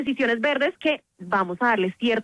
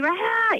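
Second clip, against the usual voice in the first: mock yell; 10 semitones higher than usual. Shown as a demonstration. Parent losing it with a child, mock yell. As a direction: let out a pretend exasperated "ah". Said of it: "yo no estoy gritando".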